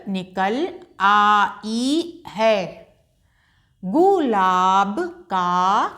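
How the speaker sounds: put-on slow, clear read-along for children; slow choral reading. Shown as a demonstration. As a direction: read each sentence slowly for them to follow. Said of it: "मेरे साथ ही साथ आप लोग बोलते चलिए।".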